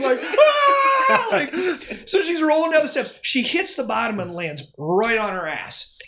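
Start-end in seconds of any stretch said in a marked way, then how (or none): 0.2-1.3 sudden high cry of shock and tears; mock surprised wail. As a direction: let out a high startled sob. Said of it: "a mixture of surprise and crying".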